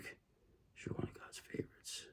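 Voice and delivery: hushed, breathless secret whisper; a confiding whisper to the viewer. Acted.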